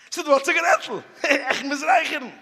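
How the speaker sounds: high, loud, laughing plea; desperate smoker. Acted. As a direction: act out an urgent craving. Acting smoker begging for cigarette. (א פארצווייפלטער רייכערער, הויך און אויפגערעגט)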